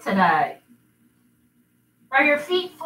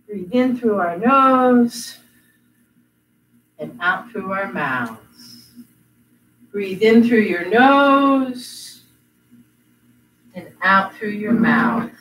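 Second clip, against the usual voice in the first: guided breathing; 6 semitones lower, slower than usual. Acted. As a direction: guide them calmly through each breath. Leads breathing.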